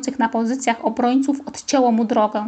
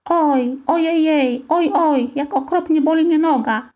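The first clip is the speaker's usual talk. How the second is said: feigned pain; 4 semitones higher than usual. A narrator's reading. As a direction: moan as if badly hurt, hammed-up. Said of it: "stękałem i zwijałem się w udawanym cierpieniu".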